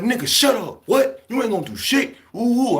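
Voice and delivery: high, heated taunt back; high mocking comeback. Young inmate mocking the cocky older foe, high and heated. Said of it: "I'm like".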